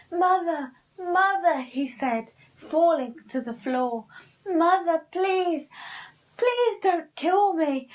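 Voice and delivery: high, loud, frightened begging; terrified pleading. Acted. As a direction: plead for your life in panic. Pinkle begging for his life.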